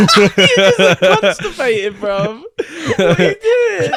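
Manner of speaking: high, loud laughing through words; can't stop laughing while talking; laughing hard. Real, not acted. Bursts out laughing at face.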